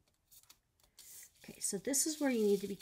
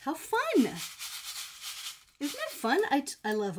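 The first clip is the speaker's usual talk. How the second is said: excited; 4 semitones higher, 4 dB louder than usual. Real, not acted. Delighted at finished shaker.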